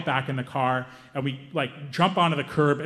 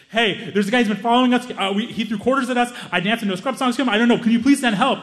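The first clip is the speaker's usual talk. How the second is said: higher, louder, fast frantic plea; panicked call; 8 semitones higher, 4 dB louder, faster than usual. Retold or imitated. Caller pleading with the police operator, frantic. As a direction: babble to the dispatcher in panic. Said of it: "I gotta call nine one one".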